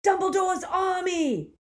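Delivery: high, loud rallying shout; rally the crowd with a cry. A narrator's reading. Neville rallying the defenders, loud shout. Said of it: "he shouted".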